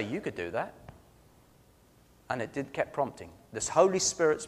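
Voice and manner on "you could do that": quiet, low voice from within; little inner voice. Retold or imitated. Retelling the Lord's prompt to him, little inner voice. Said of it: "had a little voice inside me say".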